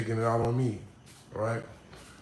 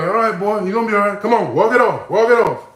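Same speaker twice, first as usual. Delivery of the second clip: much higher and louder, hearty shout; old-school coach; 8 semitones higher, 10 dB louder than usual. Retold or imitated. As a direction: bark encouragement across the field. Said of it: "they be like".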